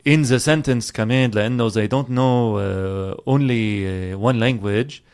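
halting Lebanese accent, slipping into Arabic. Shown as a demonstration. Showing how Lebanese mix languages, Lebanese accent. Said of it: "give us some Lebanese".

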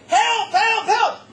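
high, loud, desperate screams; screaming for help. Retold or imitated. Boy chased by dogs screams to the crowd, desperate shout. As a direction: yell for help as if your life depends on it. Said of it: "I'm just screaming".

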